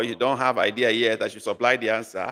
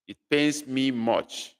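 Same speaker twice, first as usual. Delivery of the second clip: slow clear translation. Shown as a demonstration. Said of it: "we're talking about losing something".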